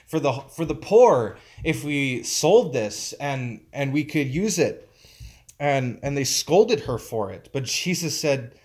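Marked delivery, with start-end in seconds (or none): none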